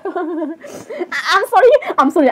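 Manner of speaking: ordinary delivery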